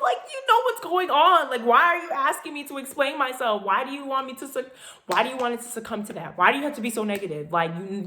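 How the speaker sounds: loud, high, rushing exasperation; exasperated inner rant. Retold or imitated. Inner rant at her father.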